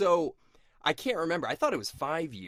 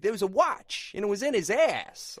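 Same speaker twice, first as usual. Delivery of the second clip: a quick mock Walken line, higher and louder; Walken impression; 10 semitones higher, 6 dB louder than usual. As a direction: toss off a Walken quote, half-joking. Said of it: "doing that inflection of him".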